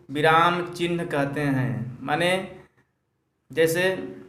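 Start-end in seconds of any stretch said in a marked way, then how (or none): none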